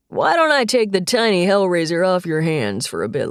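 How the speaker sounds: lightly voiced amused grandpa; a hint of a teasing grandfather; old man voice. Grandfather character voice.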